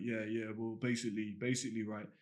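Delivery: mock the mumbling cool kid, words half swallowed; mocking a guy who swallows his words to sound cool. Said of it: "Trying to mumble and be really cool".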